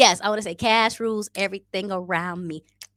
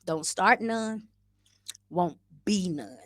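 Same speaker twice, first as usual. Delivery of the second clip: cold gangster delivery; slower than usual. Pretend. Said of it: "I just wanna be that cold".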